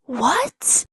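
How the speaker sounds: sharp, rising disbelief; incredulous outburst. Acted. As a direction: burst out, baffled. Disbelief at characters' wish for dinner.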